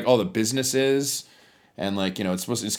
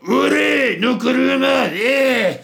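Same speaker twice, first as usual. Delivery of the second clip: much higher, louder rough factory-worker shout; brash worker yell; 8 dB louder, much slower than usual. Retold or imitated. Imitating rowdy Kitakyushu workers, a loud rough yell. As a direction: bark it like a brawling factory guy. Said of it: "Dude, careful, man. My neighbors are cops".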